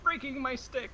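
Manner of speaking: put-on high, slow, wailing old sailor; sad salty man. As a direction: moan it like a heartbroken salty man. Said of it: "a very sad, salty man".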